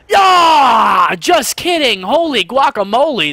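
sudden loud, high whoop of victory; triumphant shout. Real, not acted. Gamer whooping at his teammates over a lucky kill, loud and high. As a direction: yell it out like you just won the round.

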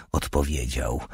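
plain reading voice

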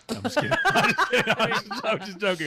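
laughing. Real, not acted. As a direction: giggle the apology out. Laughing after teasing joke.

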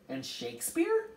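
high, eager offer; calling out. A narrator's reading. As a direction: call out a name, pitched high. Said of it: "Charles Wallace called out".

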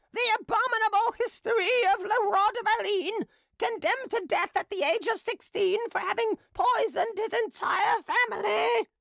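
very high, loud street-crier call; hawker crying wares. Acted. Drunken hawker crying her wares over the crowd, high loud call. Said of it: "the voice of a woman crying".

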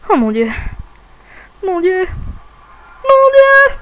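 terrified shriek, high and loud; panicked scream. Acted. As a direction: scream it out in terror. Fleeing the monsters, screaming.

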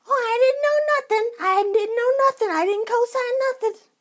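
high, loud mock wailing denial; hammed-up tearful innocence; mock crying protest. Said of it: "she cried".

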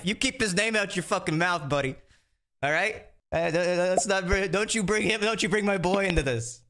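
a hint of a playful tough-guy warning; mock threat. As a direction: pretend to be protective, half laughing. Joking threat over Tubbo.

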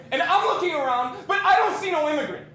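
high, loud bewildered protest; agitated retelling. Retold or imitated. Man relives childhood taunts, loud and agitated. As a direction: relive it at full volume.

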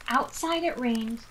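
ordinary delivery

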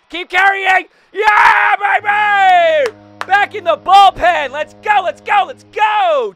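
high, full-voiced victory yell; real celebratory hollering; elated shouting. Real, not acted. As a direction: scream it out in triumph. Celebrates home run.

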